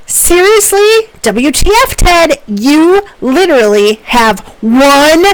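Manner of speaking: exasperated, high-pitched demand in slow, punched words; angry, aggravated, frustrated. Acted. Professor acting out Amy's email to a coworker, angry and frustrated. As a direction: act out a fuming email, dragging out key words. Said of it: "I blew out your eardrums".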